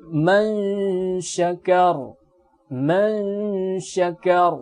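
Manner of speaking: man syakar with the stopped final ra heavy; thick ra on pause. Shown as a demonstration. Teacher demonstrating waqf on syakar to the class: heavy final ra. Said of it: "hukum ra ini dibaca tebal".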